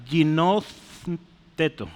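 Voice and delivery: "ginosteto" stretched out slowly; slow Greek word. Shown as a demonstration. Teacher points to the Greek word on the board, drawn out. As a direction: draw out "ginosteto". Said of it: "esta palabra".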